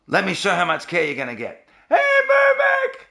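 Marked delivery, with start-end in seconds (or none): 1.9-3.1 slow, very high squeal; silly mock greeting. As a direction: squeal the name in mock welcome. Mock show of care, silly greeting.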